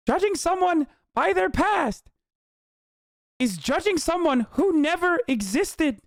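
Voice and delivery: high, slow imitation of a wise teacher; Lang Buddha voice. Quoting the great Lang Buddha, high slow sage imitation. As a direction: put on a high, solemn sage voice. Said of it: "I'm gonna do his voice".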